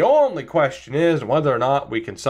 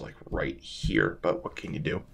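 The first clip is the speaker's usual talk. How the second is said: muttered under the breath, nearly voiceless; muttered aside; 12 semitones lower, 10 dB quieter than usual. Real, not acted. Muttering to viewers about the wall, voiceless and under the breath. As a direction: mumble it to yourself off to the side.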